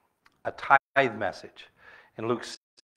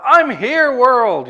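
much higher, louder hello to the world; cheerful announcement; 11 semitones higher, 7 dB louder, much slower than usual. Retold or imitated. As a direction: burst awake and greet the day. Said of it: "they wake up".

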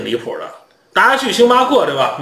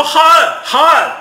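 higher, louder, slower, spelling out his name; exasperated repeating; 12 semitones higher, 4 dB louder than usual. Retold or imitated. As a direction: insist on Han with mock exasperation.

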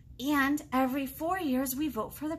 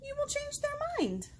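lilting read-aloud with big rises; storybook voice for little ones; sing-song picture book reading; reading to children; 8 semitones higher than usual. Acted.

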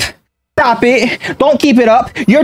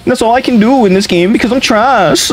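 lightly voiced whiny opponent, higher pitch; mocking the spammers; 8 semitones higher than usual. Mocking range-spamming opponents to his viewers, sneering mock voice. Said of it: "'Cause they, they made it so".

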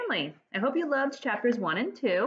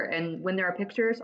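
ordinary delivery, nothing marked

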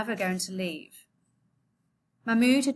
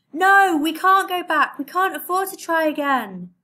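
loud, high insistent yell; 9 semitones higher, 8 dB louder than usual. Acted. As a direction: shout him down over the storm. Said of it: "Mahmoud's father yelled".

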